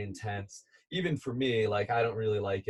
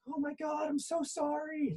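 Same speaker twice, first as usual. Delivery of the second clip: imitating her sobbing; 6 dB quieter than usual. Husband imitates his sobbing wife apologizing on the cliff, high soft sob. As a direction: play her tearful sorry, pitched way up and hushed. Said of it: "Like, she's sobbing".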